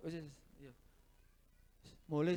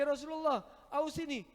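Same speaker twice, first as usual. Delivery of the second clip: urgent plea; 7 semitones higher than usual. Retold or imitated. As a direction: beg earnestly for advice. Companion asks a third time.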